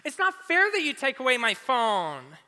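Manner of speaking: put-on high, loud whining teenager; high, loud mock-teen protest, slowing on "It's not fair"; whiny teen. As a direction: sulk about the phone in a shrill whine. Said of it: "how many times have you said".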